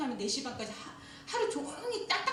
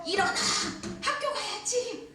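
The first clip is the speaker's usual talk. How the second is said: a nagging mother calling upstairs; mom's wake-up yell; 9 semitones higher than usual. Retold or imitated. Pastor imitates a mother waking her child, mock yell.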